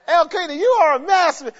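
high, loud laughing exclamation. Real, not acted. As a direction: laugh through the mock praise. Laughing at Elkanah.

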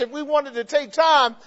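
usual speaking voice

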